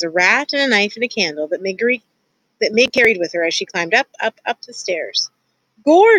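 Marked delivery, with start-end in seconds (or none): 5.8-6.2 high, loud outburst; sudden yelp, pitched way up; shouted exclamation. A narrator's reading. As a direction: shout it out to the rat. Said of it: "she shouted to the rat".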